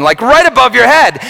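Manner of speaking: ordinary talk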